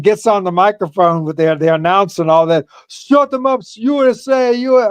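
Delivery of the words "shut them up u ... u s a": imitating Sheik's mic rant. Sheik yelling on the microphone.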